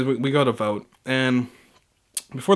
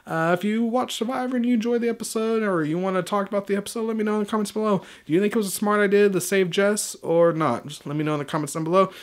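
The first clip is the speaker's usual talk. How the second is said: higher, bright sign-off to viewers, asking for comments; upbeat outro sign-off; 7 semitones higher than usual. Acted. YouTuber to viewers asking for comments, upbeat higher sign-off. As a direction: pitch it up like a friendly channel outro.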